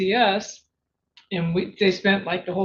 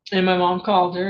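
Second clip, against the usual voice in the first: ordinary talk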